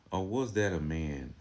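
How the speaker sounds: ordinary talk, nothing marked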